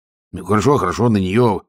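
plain reading voice

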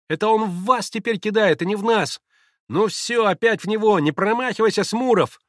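high, loud battle calls; boys shouting. A narrator's reading. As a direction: urge the gang on, childlike. Мальчишки кричат во время перестрелки. (азартные крики мальчишек)

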